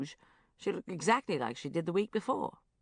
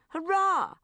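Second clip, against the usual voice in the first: a high, loud ironic cheer; mock cheer; 13 semitones higher, 8 dB louder than usual. A narrator's reading. Diarist cheering her own failures with mock triumph. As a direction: cheer it like a small sarcastic victory. Diary tally of bad habits.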